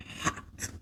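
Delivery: breathy whisper on one word; hushed, scandalized. Acted. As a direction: breathe it out like a secret. Breathless reveal of steamy scene.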